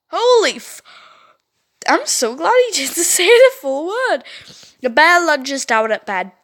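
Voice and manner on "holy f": shocked exclaim. Real, not acted. Shocked reaction.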